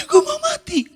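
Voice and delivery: high wailing mock cry; mock despair. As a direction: ham up a melodramatic moaner. Mocking an exaggerating churchgoer.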